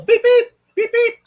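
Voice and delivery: high mock beeps, quick and bouncy; a cheerful run of high beep-beep sounds; playful beeping. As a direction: beep like a little machine to say hi. Greeting a chat member playfully.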